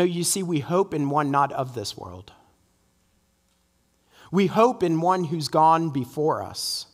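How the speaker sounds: normal talking voice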